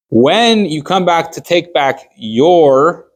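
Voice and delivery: "your" held long and set apart at the end; stress on YOUR. Shown as a demonstration. Teacher explains whose collateral is taken back, stress on "your". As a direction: lean slowly into the word "your". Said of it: "I'm emphasizing the word your".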